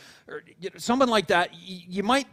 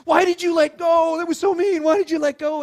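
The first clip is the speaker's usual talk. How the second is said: high, loud accusing whine; hurt little-kid outburst; wailing child; 9 semitones higher, 8 dB louder than usual. Retold or imitated. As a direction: imitate an upset kid shouting at a parent.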